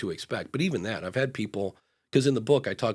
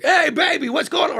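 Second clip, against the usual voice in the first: much higher, louder, leering catcall; slimy catcall; 8 dB louder than usual. Retold or imitated. Imitating a construction guy catcalling in New York. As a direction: holler it at a passerby like a sleazy builder. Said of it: "a slimy construction guy in New York".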